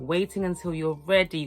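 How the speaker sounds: usual speaking voice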